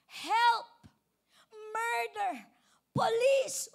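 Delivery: high, loud emergency shouts; acted desperate screams for rescue; yelling for help. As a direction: yell each word separately, as if in danger. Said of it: "do I have to yell".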